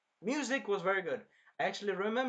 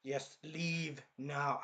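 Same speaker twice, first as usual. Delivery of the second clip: slow cold order; 3 semitones lower, 6 dB quieter, much slower than usual. Retold or imitated. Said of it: "I don't know how to do that expression".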